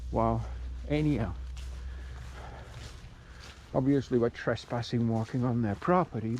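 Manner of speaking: ordinary talk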